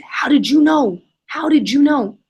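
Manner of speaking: intense, restrained re-enactment of a yelled question; retold scream. Big man shaken by his kindness card, retold scream. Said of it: "And then he starts screaming".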